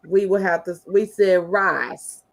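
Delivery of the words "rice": "rice" with a drawn-out country vowel; country pronunciation. Shown as a demonstration. Showing how we talked on the farm, country "rice". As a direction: stretch "rice" slowly, the farm way. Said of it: "we couldn't say rice".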